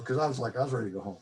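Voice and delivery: ordinary talk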